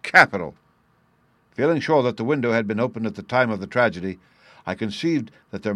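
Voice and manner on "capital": loud, punchy exclamation of approval; delighted cry. A narrator's reading. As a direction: cry out like an impressed inspector. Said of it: "cried the inspector".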